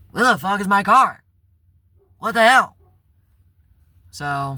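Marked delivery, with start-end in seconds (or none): 0.1-2.8 high, loud disbelieving yell; angry shout. Retold or imitated. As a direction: shout it at the empty parking spot. Car missing, shouting profanities.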